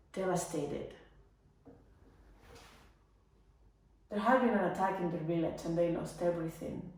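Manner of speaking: low, hushed and heavy; softly grieving; subdued sorrow. Acted. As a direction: recall his despair with quiet grief. Recalls his devastation after attack.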